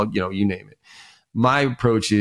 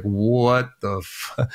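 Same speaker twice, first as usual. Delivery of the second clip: slow, stunned "what the"; mock stunned waitress; much slower than usual. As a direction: drag it out in disbelief. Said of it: "the waitress is like".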